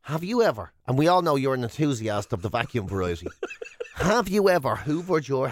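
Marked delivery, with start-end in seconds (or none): none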